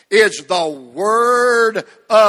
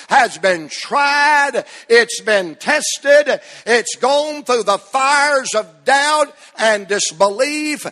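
raised, ringing pulpit voice, higher and driving; preaching at full force; 3 semitones higher, 4 dB louder than usual. Acted. Preacher to his congregation about the Word, full-force preaching. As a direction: lift the congregation with every phrase.

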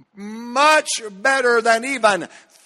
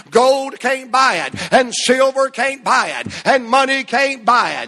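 preaching at full force; 2 semitones higher, 3 dB louder, faster than usual. Acted. Preacher to his congregation about the Word, full-force preaching. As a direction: lift the congregation with every phrase.

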